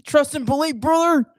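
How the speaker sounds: loud pro-wrestler shout; Hulk Hogan. Acted. Co-host copying the wrestler line, loud wrestler impression. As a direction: punch it out like a wrestling promo. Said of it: "Okay, do Hulk Hogan".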